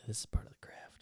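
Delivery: hushed, whispered aside; playful whisper. Pretend. Host riffing on a shady alien shop, playful whisper. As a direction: whisper it like a secret in a bit. Mock sales whisper as a bit.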